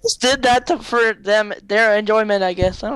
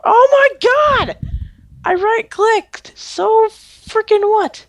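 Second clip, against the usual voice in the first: high, slow frustrated yell; exasperated yelling; 12 semitones higher, 3 dB louder than usual. Real, not acted. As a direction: shout at the game in frustration. Furious at the game.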